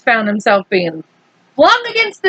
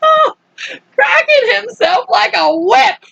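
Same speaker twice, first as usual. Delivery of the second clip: laughing; 9 semitones higher than usual. Real, not acted. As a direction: read through giggles. Narrator laughing mid-reading.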